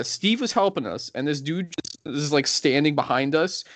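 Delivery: ordinary delivery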